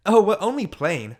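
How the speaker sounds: put-on a girl backs off the fight, feigning innocence; mock innocent protest. Girls quarrelling over insults.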